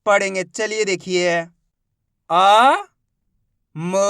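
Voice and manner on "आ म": आ and म held long, one at a time; drawn-out letter drill on आ and म; sounding out letters. Shown as a demonstration. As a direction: call out आ, then म, each stretched and separate. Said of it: "किस तरीके से अपन इन शब्दों को".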